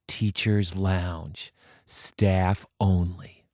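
very slow, solemn reading of the sign; slow ominous reading. Acted. Kids reading the door sign, slow and ominous. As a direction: read it like a warning carved in stone.

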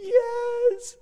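put-on very high, tearful little-kid wail; mock toddler crying; crying child. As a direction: imitate a sobbing small boy. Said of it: "he's crying".

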